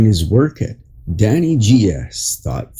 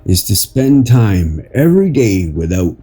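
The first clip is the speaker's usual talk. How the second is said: inspirational reading; 4 semitones higher than usual. Acted. Reads an inspirational article aloud.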